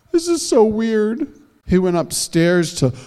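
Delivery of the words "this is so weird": put-on high, slow, bewildered child; lightly voiced boy puzzling it out; puzzled boy voice.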